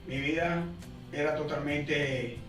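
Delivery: normal talking voice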